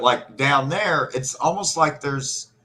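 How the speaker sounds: usual speaking voice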